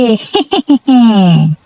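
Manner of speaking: contented low chuckle; happy laugh. Acted. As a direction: laugh along, pleased. Laughs at boasting about business.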